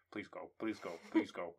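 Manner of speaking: low, quiet repeated begging; hushed pleading. Acted. Hero crammed in cupboard wills person away, hushed pleading. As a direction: mutter it as if hiding.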